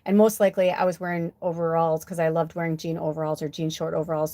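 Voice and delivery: ordinary delivery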